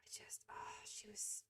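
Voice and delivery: soft unvoiced whisper. Real, not acted. Explaining the cat to viewers, in a whisper.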